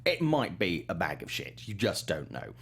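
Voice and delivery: ordinary talk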